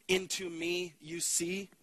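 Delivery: slow, each word set apart: "Into me you see"; slow wordplay. Shown as a demonstration. Speaker unveiling a pastor's pun on intimacy, slow and deliberate. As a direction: spell out the pun slowly. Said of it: "Did you see what I did there?".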